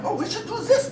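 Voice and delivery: put-on very high German accent; hammed-up German nag; German inner critic. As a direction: fret in a squeaky German accent. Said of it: "inner critic is made of my German D N A".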